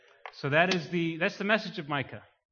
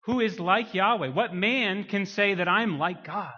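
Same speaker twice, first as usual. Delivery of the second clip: loud, raised preacher's challenge; full-force preaching; 5 semitones higher, 6 dB louder, slower than usual. Real, not acted. Preacher challenging any man claiming to equal God, impassioned preaching. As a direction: ring the question out over the congregation.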